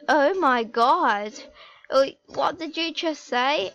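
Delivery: mock shock, stretched out then rising into a question. Fia walks back in on a secret, shocked. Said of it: "she did the shocked face".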